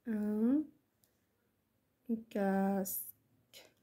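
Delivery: very slow, pause after ان; word-by-word dictation. Shown as a demonstration. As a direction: say ان, wait, then stretch كاسك right out. Repeats word by word for writing. (إملاء بطيء جدا كلمة بكلمة)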